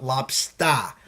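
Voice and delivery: Boston "lobstah", R dropped, drawn out; Boston accent. Shown as a demonstration. Host mocking his own speech, Boston accent "lobstah". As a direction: say "lobster" slowly as a Bostonian "lob-stah". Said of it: "it's the accent".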